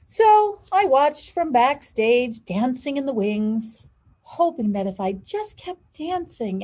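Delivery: lively storybook read, big pitch swings; animated picture book narration; reading to children. A narrator's reading. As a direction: read aloud to kids with expression. Reads picture book aloud.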